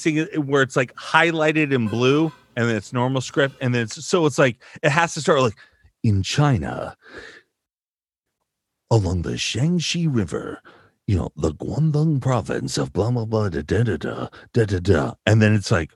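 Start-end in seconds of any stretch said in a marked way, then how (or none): 6.0-15.1 low documentary-trailer narration; mock-epic voiceover opening; epic narrator. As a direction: intone it grandly, deep and measured. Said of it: "an epic thing at the beginning".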